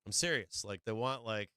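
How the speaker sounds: ordinary talk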